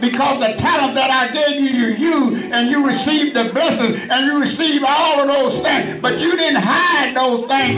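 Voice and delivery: fiery preaching. Acted. Preacher rousing the congregation to serve God, shouted preaching. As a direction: preach it out hard to the whole church.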